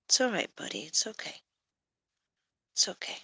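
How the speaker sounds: soft whisper to a skittish cat; whispered comfort; hushed soothing. Real, not acted. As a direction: breathe the words so he stays.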